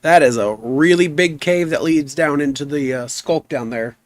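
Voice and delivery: ordinary delivery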